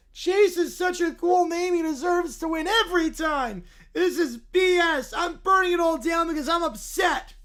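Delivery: put-on whiny angry little boy, shouting in bursts; furious child voice yelling at the town; Caillou tantrum. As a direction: throw a bratty tantrum as a spoiled kid. Caillou voice continues.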